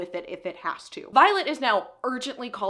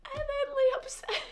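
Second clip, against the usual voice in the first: crying through narration. Real, not acted. Reader recapping a death scene, voice breaking. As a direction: voice cracking up high into a teary laugh. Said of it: "I cried the first time I read this scene".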